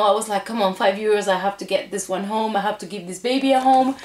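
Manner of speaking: ordinary talk, nothing marked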